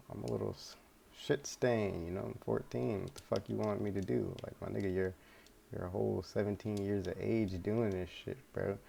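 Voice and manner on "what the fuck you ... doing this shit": ordinary talk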